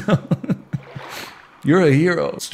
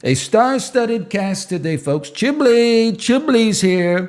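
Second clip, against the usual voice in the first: busted Trump impression; 8 semitones higher, slower than usual. Streamer doing his busted Trump impression for chat. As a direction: thank them like Trump at a podium. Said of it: "my busted Donald Trump impression".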